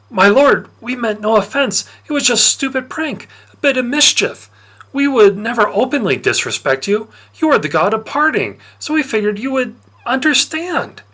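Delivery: put-on high, pleading boy's voice; pleading young Patroclus. Patroclus begging Lord Dionysus for mercy, high and pleading.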